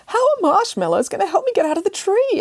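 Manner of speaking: high, fretful cat; a worried cat wants down; anxious cat voice. Acted. Cat questioning Nanny Piggins, high and fretful.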